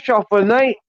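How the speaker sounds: ordinary delivery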